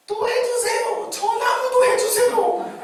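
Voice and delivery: put-on a child squealing at the pastor, laughing; excited child begging. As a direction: beg like a thrilled toddler.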